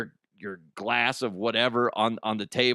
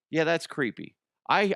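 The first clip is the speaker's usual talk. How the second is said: ordinary talk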